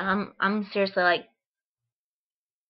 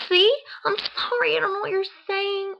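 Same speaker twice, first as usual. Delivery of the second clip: much higher, squeaky nervous apology; flustered apology; 10 semitones higher, 3 dB louder than usual. Retold or imitated. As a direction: panicky and helpless.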